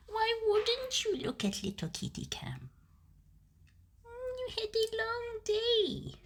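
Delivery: high, soft cooing. Real, not acted. Streamer cooing at the kitty cam, high baby talk. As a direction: coo gently in a tiny high voice.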